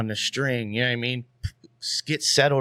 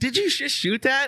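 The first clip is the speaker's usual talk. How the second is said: high, incredulous question; amazed; 12 semitones higher than usual. Retold or imitated. Imitating friend asking about the fresh kill, amazed. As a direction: imitate a friend's astonishment, voice pitched up. Quotes friend reacting to photo.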